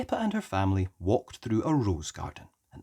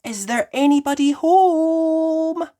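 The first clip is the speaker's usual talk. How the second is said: louder, higher slow call; Mummy Pig calling; 10 dB louder, slower than usual. Acted. Mummy Pig peers into the open cottage, loud high call. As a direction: call out wondering who lives here. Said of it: "asked Mummy Pig".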